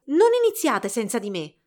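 loud, eager call; shriek. A narrator's reading. Giselle running up to the dancers, shrieked plea. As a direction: call out loudly to stop them. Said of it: "strillò".

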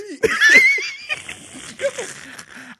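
long, high wheezing laugh. Real, not acted. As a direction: lose it laughing. Long laugh at own story.

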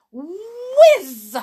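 high, loud swooping whiz; whizzing effect. A narrator's reading. Boy shooting off banister, whizzing sound. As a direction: swoop up through the word. Narrator voices flying boy.

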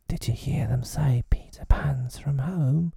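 high, breathy conspiratorial whisper. A narrator's reading. Smee whispering to Hook near the hideout. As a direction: hush it so no one hears. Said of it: "Smee whispered".

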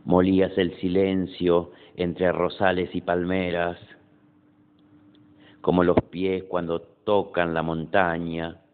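measured poem reading with pauses after each line; poetry recitation. Acted. Poet reading own poem Noche to an audience, measured recitation.

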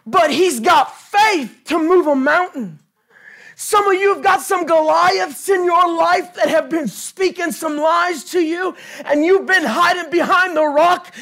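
fiery shouted sermon climax; preaching at full force. Acted. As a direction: preach it hard, rallying the church.

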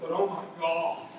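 low, grim preacher voice; a preacher breaking bad news; funeral preacher. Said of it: "the preacher stands up and says".

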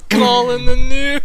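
high, loud, slow laughing repeat; mocking through laughter. Real, not acted.

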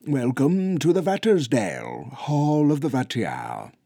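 big, high, loud ceremonial welcome; announcing elder Bigfoot. Acted. Bigfoot elder welcoming Luke to the hall, grandly announcing. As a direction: proclaim it to the hall like a giant elder. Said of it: "Athelwald announced".

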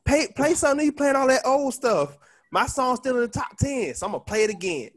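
high, cocky, taunting rapper voice; [name] trash talk. Retold or imitated. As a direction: taunt him, half-singing. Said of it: "Soulja was talking hella shit".

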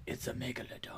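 lightly voiced Jason Statham, dropped to a murmur; Jason Statham impression. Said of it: "that's a good Statham impression".

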